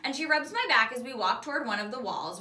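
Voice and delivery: plain reading voice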